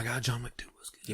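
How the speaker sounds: breathy whispered awe; whispering. Real, not acted. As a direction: whisper it like a secret. Whispered agreement.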